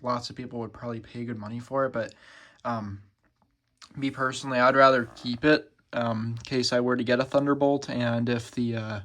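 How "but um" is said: ordinary talk, nothing marked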